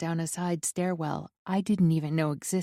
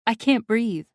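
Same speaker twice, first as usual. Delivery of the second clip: ordinary delivery, nothing marked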